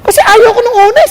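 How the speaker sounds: choking up while remembering; tearful. Real, not acted. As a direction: voice breaking with tears. Pastor retells resisting God, crying.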